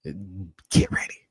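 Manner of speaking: whisper. Acted. Ominous warning to viewers.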